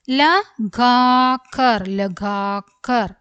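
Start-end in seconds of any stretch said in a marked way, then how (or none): 0.1-1.8 slow reading, each syllable apart. Shown as a demonstration. Teacher going over the word for learners, syllable by syllable. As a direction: separate and stretch every syllable.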